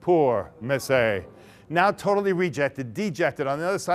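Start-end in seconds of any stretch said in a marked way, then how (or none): 0.0-1.2 lower, very slow mock-sad sigh of pity; milk the pity, slowly. A narrator's reading. Narrator pities rejected heroine.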